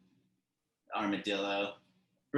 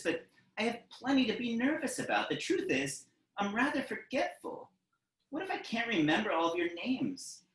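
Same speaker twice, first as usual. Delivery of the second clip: higher, fretting armadillo teacher voice; worried teacher; 7 semitones higher than usual. Acted. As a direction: fret in a higher voice about forgetting. Armadillo teacher admits his worries.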